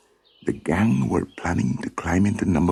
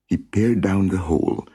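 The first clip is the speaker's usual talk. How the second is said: usual speaking voice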